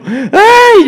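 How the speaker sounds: high-pitched mock crying wail; mock child-like wail. Pastor imitating a man crying on the phone, mock wail. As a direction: wail like a little kid, hammed up. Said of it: "lloraba como un niño".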